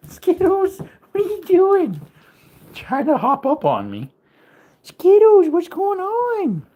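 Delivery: high cooing voice for the puppy; high sing-song pet talk. Real, not acted. Baby talk to dog.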